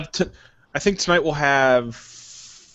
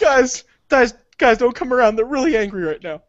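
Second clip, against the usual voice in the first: high-pitched, hurried pretend alarm; mock panicked warning; 11 semitones higher, 4 dB louder than usual. Warning friends about angry bees, mock panic. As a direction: warn everyone off in a high voice.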